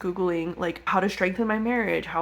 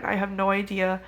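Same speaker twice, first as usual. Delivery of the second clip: ordinary talk, nothing marked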